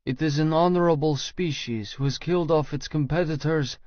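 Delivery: ordinary talk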